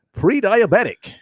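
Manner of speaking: slow, mock-announcer read; movie-phone voice. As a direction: draw out each item like a commercial voiceover. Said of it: "read it in your Claude voice".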